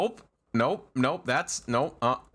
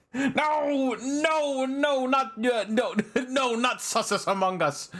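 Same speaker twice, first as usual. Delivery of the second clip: flustered denial; 9 semitones higher than usual. Pretend. Flustered denial of chat joke.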